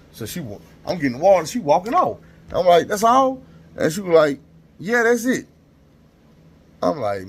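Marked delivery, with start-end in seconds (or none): 2.9-3.4 much higher, louder incredulous question; can't believe that's it; disbelief. Retold or imitated. Surprised he only gets Tums. 4.8-5.5 put-on much higher, offhand older nurse; the nurse dismissing him on her way out; breezy nurse. Nurse answers walking off.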